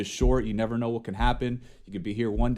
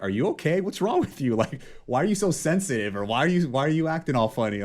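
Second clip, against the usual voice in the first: confused friends; 6 semitones higher than usual. Retold or imitated. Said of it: "They're like".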